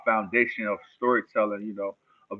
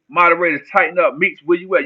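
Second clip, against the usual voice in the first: calling out; 6 semitones higher, 6 dB louder than usual. Real, not acted. Streamer calls on his moderators.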